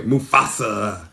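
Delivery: low, slow mock hyena growl of Mufasa. Imitating the Lion King hyenas saying Mufasa, slow and low. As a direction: growl the name like a scared hyena. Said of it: "they were going".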